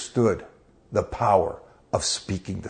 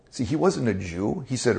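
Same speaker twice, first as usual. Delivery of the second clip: ordinary delivery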